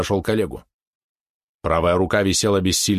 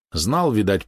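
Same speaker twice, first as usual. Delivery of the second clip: ordinary delivery, nothing marked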